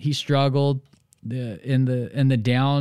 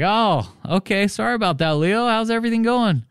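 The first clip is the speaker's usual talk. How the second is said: friendly reply; 10 semitones higher than usual. Retold or imitated.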